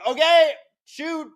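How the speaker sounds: squeaky falsetto, slow and timid; hammed-up cowering defender offering himself up; mock soccer player. Mocking soccer players covering up in the wall, squeaky.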